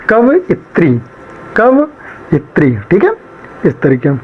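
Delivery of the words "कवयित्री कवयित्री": कवयित्री repeated slowly and higher, every syllable clear; careful repetition. Shown as a demonstration. Teacher repeats कवयित्री for students, slow and careful. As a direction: drill कवयित्री twice for the class to memorize. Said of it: "आप लोग ऐसे याद करा करो".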